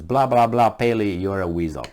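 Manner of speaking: ordinary delivery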